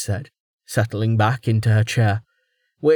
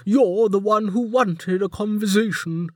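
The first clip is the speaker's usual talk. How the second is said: warden voice; 11 semitones higher than usual. A narrator's reading.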